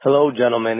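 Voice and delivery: normal talking voice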